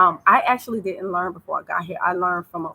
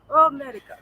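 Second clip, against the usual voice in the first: put-on raised, louder Tanzanian-accented English; 6 semitones higher, 5 dB louder than usual. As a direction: exclaim it like a Tanzanian local. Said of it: "That's how they say it".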